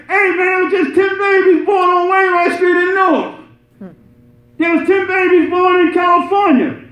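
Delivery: a hint of a TV newsman, shouted; preacher mimics a TV report of births; mock TV news shout. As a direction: shout it like a breaking-news headline. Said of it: "how often do you hear them on T V say".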